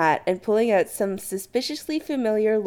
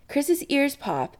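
usual speaking voice

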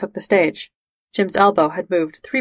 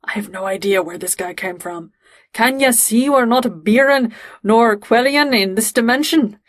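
put-on thick Scottish accent; 3 semitones higher than usual. Jim acting out another guy in his anecdote, thick Scottish accent. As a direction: put on a broad Scottish accent, stretching the words out. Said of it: "in this really thick Scottish accent".

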